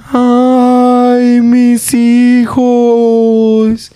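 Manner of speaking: high, loud, drawn-out ghostly wail; weeping ghost woman. Retold or imitated. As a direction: wail like a mother crying for her lost children. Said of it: "It was like".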